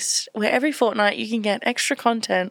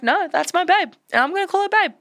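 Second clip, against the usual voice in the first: insistent; 7 semitones higher than usual. Retold or imitated. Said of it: "he stuck through it and he was like".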